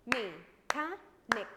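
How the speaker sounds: "Mechanic" in three loud, slow, separate beats; split into syllables. Shown as a demonstration. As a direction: chop "Mechanic" loudly into three syllables with pauses. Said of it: "Let's divide it into syllables".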